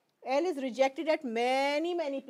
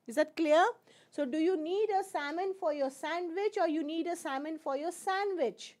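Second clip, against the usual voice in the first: ordinary delivery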